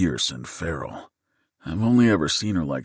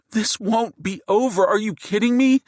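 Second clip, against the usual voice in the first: much higher and louder, furious shout; 4 dB louder than usual. Acted. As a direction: yell it at the masked man in outrage. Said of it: "and she's shouting".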